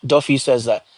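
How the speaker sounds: usual speaking voice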